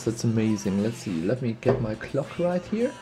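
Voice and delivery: ordinary talk, nothing marked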